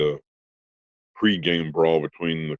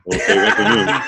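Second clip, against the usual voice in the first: laughing; 5 dB louder than usual. Real, not acted. Laughing echo of friend's warning.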